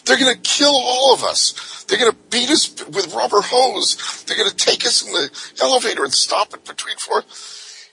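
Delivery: high, urgent frightened plea; panicked pleading. Retold or imitated. Chained prisoners begging Tucker to shut up, high and panicked. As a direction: beg him to stop, scared. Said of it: "pleading with me".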